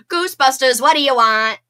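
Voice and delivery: a loud, punchy take on the movie line; loud movie-line impression; movie quote impression. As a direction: shout it like the film. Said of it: "Like from the movie".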